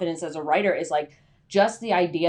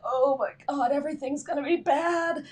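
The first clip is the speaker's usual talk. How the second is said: higher, put-on panicky voice; mock dread; 7 semitones higher than usual. Writer imagining disaster, in mock dread. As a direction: ham up the doom a little. Voicing panic, far timbre.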